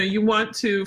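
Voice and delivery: ordinary delivery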